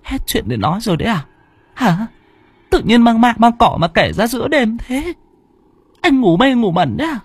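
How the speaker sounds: a wife snapping at her husband for scary talk; shrill, exasperated scolding. A narrator's reading. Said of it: "trợn mắt mắng chồng".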